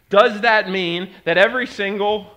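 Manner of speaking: loud, high pulpit voice; impassioned preacher asking; preaching. Acted. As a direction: press the question on the crowd. Preaching at full force.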